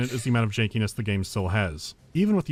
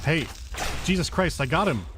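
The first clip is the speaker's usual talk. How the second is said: startled outburst; 6 semitones higher than usual. Real, not acted. Startled by enemy during play.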